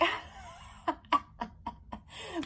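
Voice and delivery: high unvoiced cackle; mocking cackle.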